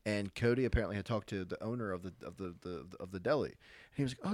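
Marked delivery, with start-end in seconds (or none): none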